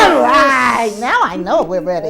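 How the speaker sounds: loud, high hype-up cheer; rousing shout. Real, not acted. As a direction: pump up the room. Host pumping up the kids.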